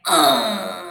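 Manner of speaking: low, long grumbling growl; bear growl. Acted. Mister Bear woken by his sneeze, low angry growl. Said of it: "He snarls and he growls".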